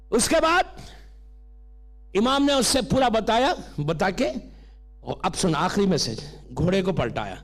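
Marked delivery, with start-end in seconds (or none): none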